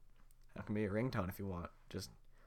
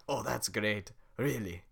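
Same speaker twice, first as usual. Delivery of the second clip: retake; 6 dB louder, slower than usual. Acted. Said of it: "Let me try that again".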